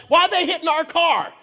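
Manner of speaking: high, loud indignant protest; retold alarmed question. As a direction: act out startled outrage at the attack. Retold alarm as car is beaten.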